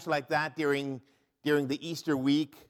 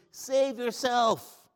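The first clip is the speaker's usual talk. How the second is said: drawn-out, higher sneer of a soldier; mocking taunt; 10 semitones higher, 3 dB louder, much slower than usual. Soldier taunting Jesus on the cross, slow high mockery. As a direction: stretch it slowly in scorn. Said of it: "mocked him".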